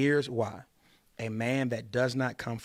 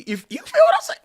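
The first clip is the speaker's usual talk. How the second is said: high squeal; 13 dB louder, faster than usual. Acted. Pickup line swoops high.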